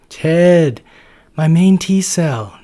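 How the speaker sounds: high, loud, slow, drawn-out greeting; excited exclaim. Acted. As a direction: Natasha thrilled to see her buddy. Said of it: "Natasha exclaims".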